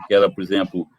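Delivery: ordinary talk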